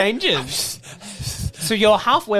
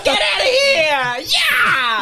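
much higher, shouted, laughing through it; old woman's battle cry; much slower than usual. Acted. Old Catherine yells the werewolf out of the house, high and shouting. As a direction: chase the beast away.

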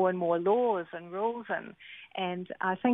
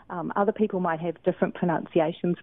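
normal talking voice

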